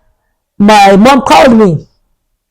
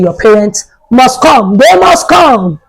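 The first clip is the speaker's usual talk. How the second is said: stern shout; slower than usual. Retold or imitated. Principal demanding the parents come, slow stern shout. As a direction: lay down the law, word by word. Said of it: "Mister George now said".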